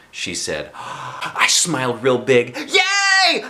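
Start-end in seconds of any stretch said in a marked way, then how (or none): 2.6-3.4 very high loud cheer; hollered yay. A narrator's reading. Junie B cheering for the fruit cake, loud high whoop. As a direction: whoop for joy. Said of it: "I hollered".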